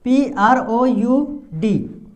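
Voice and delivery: पी आर ओ यू डी read letter by letter, very slowly; spelling out letters. Shown as a demonstration. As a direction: spell पी आर ओ यू डी with long gaps. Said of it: "अब यहाँ पे देखिए".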